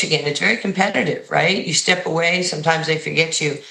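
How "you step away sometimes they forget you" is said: ordinary talk, nothing marked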